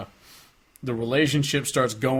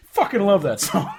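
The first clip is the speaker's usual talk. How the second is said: higher, enthusiastic "fucking love that song"; gushing quote; 8 semitones higher, 4 dB louder than usual. Retold or imitated. Host mocking own habit of gushing, higher voice. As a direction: imitate yourself gushing. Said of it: "I'm just like".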